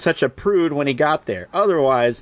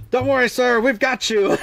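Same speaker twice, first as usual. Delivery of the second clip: much higher mock heroic voice; heroic rescuer; 10 semitones higher than usual. Lancelot bursting in to save Galahad, mock heroic and high. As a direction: burst in like an over-eager rescuer. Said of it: "Lancelot busts in and is like".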